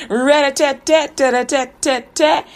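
rhythmic, drawn-out rat-a-tat; imitated knocking. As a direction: rap out a playful door knock. Imitates knocking on mayor's door.

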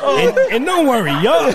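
high, strained through laughter; laughing. Real, not acted. Host tries to go on while cracking up, high and breathless. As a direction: try to talk while still laughing.

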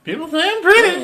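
loud, very high mocking falsetto; mock girl voice. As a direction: parrot it back in a squeaky put-on girl voice. Mocking the caller's fake girl voice.

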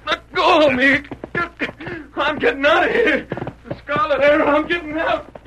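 high, frantic cries of terror; a scared man shouting as he tries to escape; panicked shouting. Acted. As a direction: yell it like you're running for your life.

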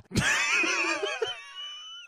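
shrieking laugh. Real, not acted. Big laugh.

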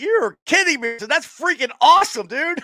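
high-pitched, thrilled disbelief; flipping out. Retold or imitated. Friend reliving hearing Zim's seat news, flipping out. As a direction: re-enact being blown away, voice jumping up. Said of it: "I flipped out".

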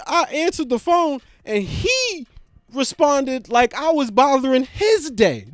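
usual speaking voice

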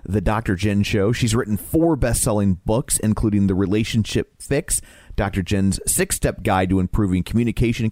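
ordinary delivery

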